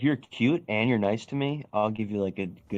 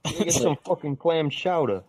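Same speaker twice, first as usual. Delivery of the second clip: hammed-up New Jersey accent, pitched higher, leaning on "chowder"; mock New Jersey accent; 7 semitones higher than usual. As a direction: put on a jokey New Jersey voice to order "clam chowder". Mocking New Jersey chowder talk.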